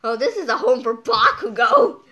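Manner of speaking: loud, thrilled outburst; excited exclaim. Real, not acted. Fan spotting a home for Bakugo, loud excited exclaim. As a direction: shout it like a big discovery. Connects sun to favorite character.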